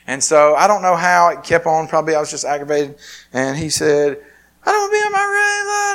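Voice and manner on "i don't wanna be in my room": very high, loud mock tantrum; imitated screaming child. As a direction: screech it like a little boy throwing a fit. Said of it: "screaming to the top of his lungs".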